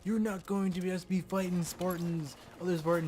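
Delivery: mock hyped ad announcer; loud, high hype-man sales pitch; switching impression voices. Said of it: "they advertise that, like".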